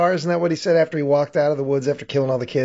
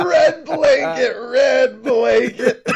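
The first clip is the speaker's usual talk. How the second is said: slow, higher, laughing through the yell; laughing mock scream; 8 semitones higher, much slower than usual. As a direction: imitate him bellowing at the river, cracking up. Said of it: "He's just screaming it at the river".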